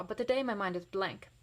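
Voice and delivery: ordinary delivery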